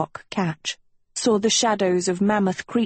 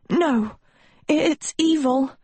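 wheezing; 8 semitones higher, slower than usual. A narrator's reading. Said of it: "Agatha wheezed".

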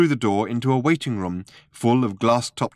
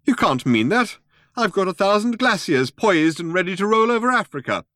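high, loud, aghast protest; 11 semitones higher, 5 dB louder than usual. A narrator's reading. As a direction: protest in horrified disbelief. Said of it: "cried Slarty Bartfast aghast".

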